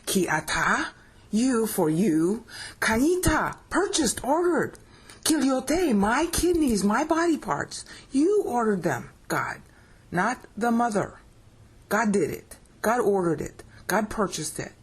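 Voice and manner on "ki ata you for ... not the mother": lifted, higher voice speaking the verse to God; lifted prayer voice, speaking straight to God. Shown as a demonstration. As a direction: pray the words up to God. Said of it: "So you can say this to God now".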